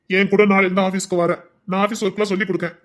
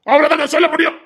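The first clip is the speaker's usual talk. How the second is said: high, louder final ultimatum; exasperated order; 9 semitones higher, 4 dB louder than usual. Acted. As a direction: end the argument, fed up. Husband's final order to wife. (பொறுமை இழந்த கணவன் உரத்த குரலில் கட்டளையிடுகிறான்)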